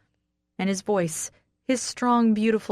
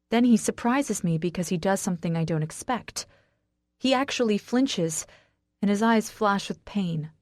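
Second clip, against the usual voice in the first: usual speaking voice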